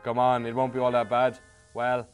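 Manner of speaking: ordinary talk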